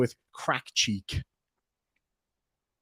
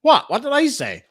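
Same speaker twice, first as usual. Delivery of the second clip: surprised; 12 semitones higher, 4 dB louder than usual. Real, not acted. Baffled by chat's reaction.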